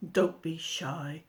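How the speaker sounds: slow coaxing whisper. A narrator's reading. The giant coaxes shy Jack in a whisper. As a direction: whisper it gently to coax him.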